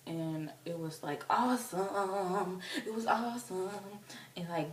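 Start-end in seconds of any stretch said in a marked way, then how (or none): 1.3-3.7 gushing. Real, not acted. Gushing about the act.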